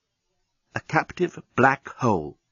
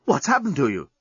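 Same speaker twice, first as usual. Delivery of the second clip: shocked; 8 semitones higher than usual. Acted. Dan shocked at Nettie.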